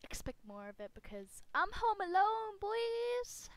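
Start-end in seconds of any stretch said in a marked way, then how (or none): 1.6-3.5 high, gleeful shout, climbing on the last word; loud, high-pitched whoop; loud holler. Real, not acted. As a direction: holler it out with the house to yourself. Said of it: "I can talk as loud as I want".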